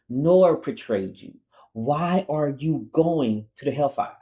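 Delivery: high-pitched, incredulous scolding; exasperated rant. Real, not acted. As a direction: rant in disbelief at a foolish choice. Ranting at a character's foolish choice.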